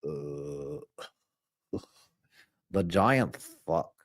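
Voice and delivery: low, slow, dopey cartoon teen; Butthead. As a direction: drop low with long grunted "uh"s and slack pacing. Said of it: "Those are my only imitation".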